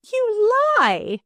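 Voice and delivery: wrathful accusation. A narrator's reading. Stepdaughter accuses her stepfather, furious high cry. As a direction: hurl the accusation at him. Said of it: "eyes blazing with wrath".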